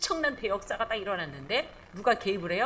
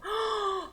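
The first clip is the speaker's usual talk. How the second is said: amazed gasp; 10 semitones higher than usual. Acted. Preacher gasps in amazement.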